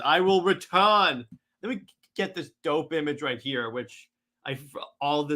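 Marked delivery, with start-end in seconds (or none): 0.0-1.2 loud, slow villain vow; drawn-out supervillain parting threat; Darkseid. Acted. Villain vows return as portal closes, loud slow threat. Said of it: "He goes".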